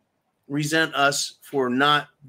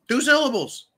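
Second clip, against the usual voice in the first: "Two syllables" pushed much higher, stress on "syllables"; exasperated; 9 semitones higher than usual. Real, not acted. Ranting to viewers about Biden, "syllables" shouted high. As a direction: hammer "syllables" in disbelief.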